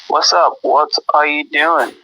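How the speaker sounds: usual speaking voice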